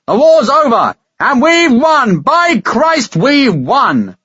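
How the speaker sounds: high, loud victorious shout to the whole room; triumphant shout. Acted. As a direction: bellow the news across the tavern. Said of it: "shouted his news to the whole tavern".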